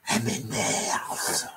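chuchoté, lent et grave; souffle-le lentement, menaçant; chuchotement sinistre. Acted. La femme à la voix bizarre. (murmure lent et inquiétant)

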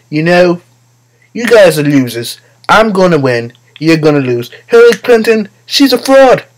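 mocking politician impression, rising in pitch and volume; Donald Trump. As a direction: build an exaggerated, boastful put-down in his voice. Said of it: "he's always talking like this".

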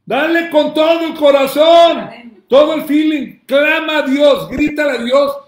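loud, high exhortation to cry out; fiery preaching. Acted. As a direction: urge the congregation with full force. Preacher urging loud prayer.

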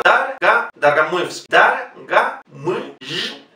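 Dar, ga, muy, y, the y held like a mix of I and U; syllable drill. Shown as a demonstration. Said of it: "otro fonema que no tenemos".

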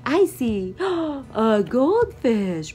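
high, loud singsong storytime voice. Real, not acted. Mother reads bedtime book to baby, high animated lilt.

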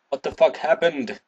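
normal talking voice

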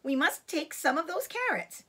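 put-on high, childlike piping up; little fox appealing to father; smallest young fox. A narrator's reading. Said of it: "said the smallest of the three small foxes".

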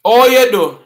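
loud, high patois "Oh, you do"; Jamaican patois. Shown as a demonstration. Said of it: "No, it's not "all you do".

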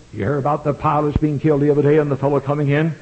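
ordinary talk, nothing marked

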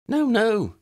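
put-on high, quick young girl cutting in; eager girl protesting. Lydia jumps in protesting.

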